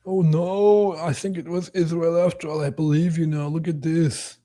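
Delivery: high-pitched put-on dismay; hammed-up alarm; mock convinced believer. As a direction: act suddenly won over, in a silly high voice. Plays convinced conspiracy believer.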